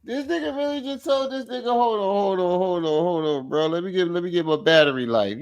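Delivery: high, soft squeal of disbelief; incredulous. Real, not acted. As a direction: start high and soft, sinking as disbelief spills out. Amazed retelling of the excuse.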